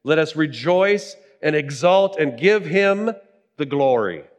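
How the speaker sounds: loud, high jubilant proclamation; crying out in praise. Acted. Preacher reads the multitude's praise to the congregation, crying out. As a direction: cry it out like a great multitude. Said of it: "crying out".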